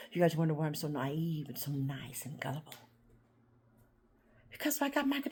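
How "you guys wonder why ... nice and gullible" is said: soft, low whispered confession. Real, not acted. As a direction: whisper it to the viewers. Confiding aside to viewers.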